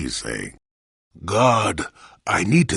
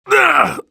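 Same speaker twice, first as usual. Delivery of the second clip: sharp pain cry; 8 dB louder than usual. Acted. Hit in combat.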